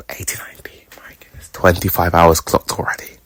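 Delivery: breathy whispered aside; whispering. Real, not acted. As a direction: whisper it to yourself in awe. Hushed aside about the game.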